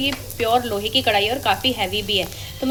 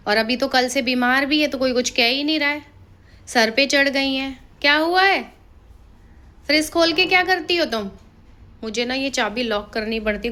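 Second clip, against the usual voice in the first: normal talking voice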